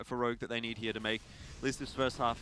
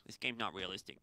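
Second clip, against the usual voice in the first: soft whiny nerd voice; 5 semitones lower, 8 dB quieter than usual. Acted. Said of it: "That was my nerd voice".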